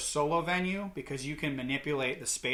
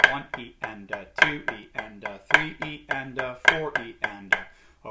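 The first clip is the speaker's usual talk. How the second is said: counting in time. Shown as a demonstration. Drum teacher demonstrating flammed paradiddles, counting in time. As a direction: count steadily on every subdivision. Counting sixteenth notes while playing.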